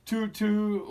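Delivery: playful toot. Acted. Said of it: "went Danny and the dinosaur".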